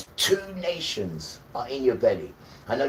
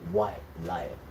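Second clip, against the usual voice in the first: ominous low naming; 6 semitones lower, 4 dB quieter than usual. Shown as a demonstration. Pointing out the ship's telling name to viewers, low and ominous. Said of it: "Look at the name they call it as well".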